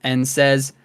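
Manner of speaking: ordinary talk, nothing marked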